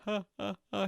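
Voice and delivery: imitated comedian's laugh, high; high mock ha-ha laugh; Eddie Murphy. Shown as a demonstration. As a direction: mimic a famous comic's spaced-out laugh. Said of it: "He has that voice".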